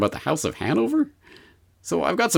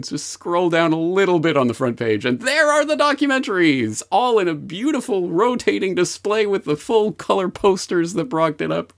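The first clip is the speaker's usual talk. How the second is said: mock cheerful; 7 semitones higher than usual. Sarcastic cheerful site tour.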